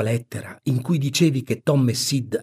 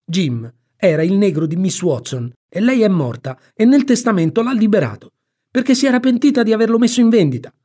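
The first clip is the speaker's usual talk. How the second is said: put-on high, louder boyish explaining, climbing in pitch; insistent boy; 9 semitones higher, 6 dB louder than usual. Tom explains the will to his aunt, high insistent boy. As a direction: explain heatedly, rising higher as you go.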